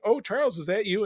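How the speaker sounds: high mock Scottish accent; surprised Scottish woman, imitated. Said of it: "In her big Scottish accent".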